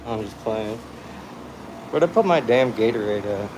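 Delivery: normal talking voice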